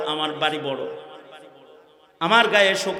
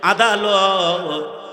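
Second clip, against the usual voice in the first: long, drawn-out tearful call to the judge; acted weeping appeal; tearful plea; 4 semitones higher, 5 dB louder, much slower than usual. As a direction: wail the word slowly, stretched out. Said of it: "চোখের পানি ছেড়ে দিয়া বলে".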